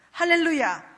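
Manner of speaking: a ringing shouted hallelujah; joyful loud hallelujah. Real, not acted. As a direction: lift it up in praise. Preacher praises God aloud.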